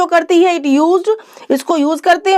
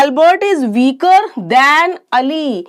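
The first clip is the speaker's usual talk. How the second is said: slow example; much slower than usual. Shown as a demonstration. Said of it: "अब हमने इसमें कहा".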